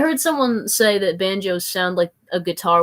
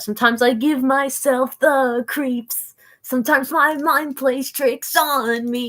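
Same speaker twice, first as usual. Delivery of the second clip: spooky voice; 6 semitones higher, 5 dB louder than usual. Acted. Spooky self-talk about creeps.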